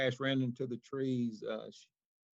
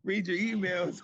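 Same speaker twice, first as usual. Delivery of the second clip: raised, higher call-out; louder repeat; 7 semitones higher, 4 dB louder than usual. Real, not acted. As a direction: call it out louder across the room.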